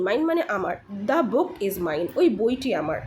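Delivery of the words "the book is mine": very slow, separated words; deliberate teacher's example reading; repeat-after-me model. Shown as a demonstration. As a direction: model it slowly for learners to copy. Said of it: "আমার সাথে repeat করুন".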